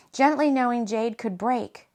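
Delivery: ordinary delivery, nothing marked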